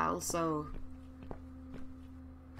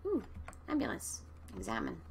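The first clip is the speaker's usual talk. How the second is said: ordinary talk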